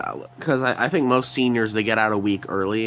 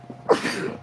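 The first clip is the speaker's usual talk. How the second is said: cough; 3 dB quieter than usual. Real, not acted. Sick, sniffling, coughs.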